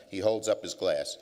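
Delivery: ordinary talk, nothing marked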